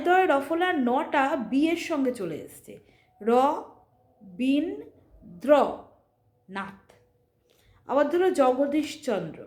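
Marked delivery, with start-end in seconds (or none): 3.2-6.8 র, বিন, দ্র, নাথ each said apart with pauses; syllable by syllable. Shown as a demonstration. Teacher spelling out a name syllable by syllable. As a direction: split the name into slow single syllables. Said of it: "এইরকমভাবে উচ্চারণ করতে হবে".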